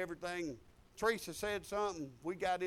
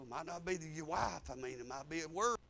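ordinary talk, nothing marked

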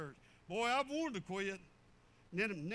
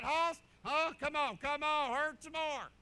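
mock taunt; 5 semitones higher, 7 dB louder than usual. Taunting himself while cutting wood.

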